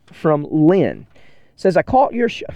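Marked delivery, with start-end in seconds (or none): 1.8-2.4 put-on high-pitched, tentative British accent; start of British accent. Podcaster begins reading a British listener's email, in British accent. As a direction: slip into a higher British accent, then break off. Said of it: "about to start doing it in her accent".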